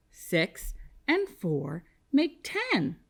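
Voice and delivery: slow, word-by-word recital; leading choral repetition. Shown as a demonstration. Teacher leading kindergartners to say it with her, slow choral pace. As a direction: space out each word so all can join. Leads class in saying it together.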